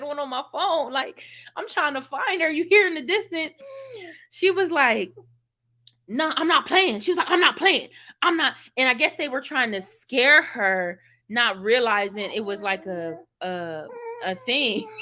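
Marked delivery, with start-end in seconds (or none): 6.1-8.5 angry insistence. Retold or imitated. Cousin yelling at scarers.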